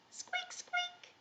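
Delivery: tiny, high mouse squeaking; mouse squeaks. Pretend. Teacher shows children the mouse cue, tiny high squeaks. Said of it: "when you hear mouse".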